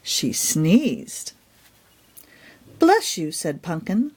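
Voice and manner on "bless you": cheerful bless you. Acted. Hen to sneezing mouse.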